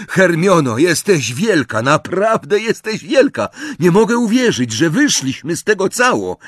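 high, loud, elated outburst; excited praise. Acted. Ron praises Hermione after escape. (podekscytowany, głośny zachwyt)

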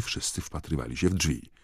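usual speaking voice

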